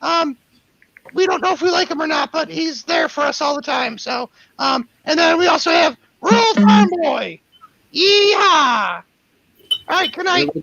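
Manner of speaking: high cartoon dog announcer. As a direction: read the patron list as the dog. Sponsor thanks in dog voice.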